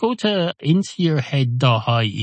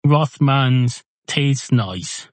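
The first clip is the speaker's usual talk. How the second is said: slow sales pitch; 4 semitones higher, much slower than usual. Acted. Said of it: "the man behind the bar says".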